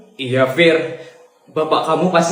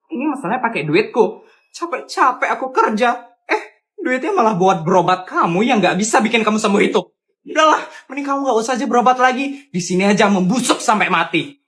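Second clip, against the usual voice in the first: angry scolding; 7 semitones higher than usual. Acted. Wife berates sick husband.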